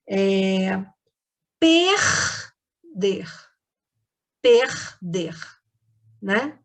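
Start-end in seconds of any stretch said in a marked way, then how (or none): 1.6-3.3 "perder" drawn out as per, pause, der; syllable split. Shown as a demonstration. Teacher giving an example of syllable-final R, "perder" split in two. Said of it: "Vou dar um exemplo". 4.4-5.3 "Perder" in two separate syllables; syllable split. Shown as a demonstration. Teacher repeating the example for pupils, "Perder" broken by syllable. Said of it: "tem esse R no final da sílaba".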